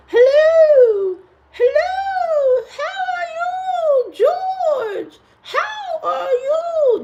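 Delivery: very high, slow, drawn-out mock greeting; exaggerated squeaky falsetto, stretching every vowel; put-on alternative voice. Shown as a demonstration. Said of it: "What voice do you want me to talk in".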